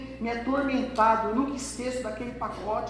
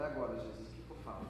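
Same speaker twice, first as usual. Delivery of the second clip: panicked aside; 10 semitones lower, 14 dB quieter, faster than usual. Acted. Young man panicking to God.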